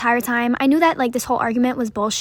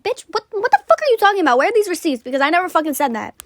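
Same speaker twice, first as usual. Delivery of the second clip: much higher, louder, outraged comeback; outraged retort; 6 semitones higher, 3 dB louder than usual. Retold or imitated. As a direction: snap back in disbelief at the accusation. She fires back at accuser.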